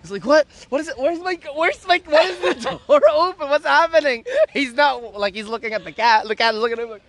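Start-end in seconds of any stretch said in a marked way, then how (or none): 0.2-4.2 high, confused mock panic; startled awake. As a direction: imitate someone jolted awake, pitch jumping up. Imitates startled, just-woken sleeper.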